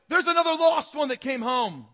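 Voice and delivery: high, very loud triumphant announcement; God's jubilant shout. Acted. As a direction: shout the good news to all heaven. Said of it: "You can see God going".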